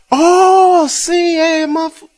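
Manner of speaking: high, bright oh of remembering; as if it all suddenly came back; a pleased, surprised oh, that's you; sudden recognition. Retold or imitated.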